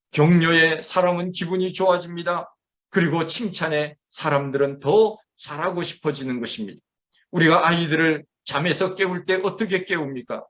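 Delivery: ordinary delivery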